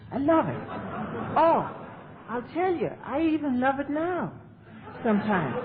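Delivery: lifted, eager gush of delight; gleeful confession of loving chaos; excited. Real, not acted. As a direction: raise the pitch and relish it. Gushing about loving chaos.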